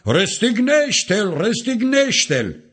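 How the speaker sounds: high, drawn-out cry, restrained in volume; narrated shout, pitched far up; crowd's cry. A narrator's reading. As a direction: chant it slowly and high like a demanding mob. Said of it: "dar ei au strigat".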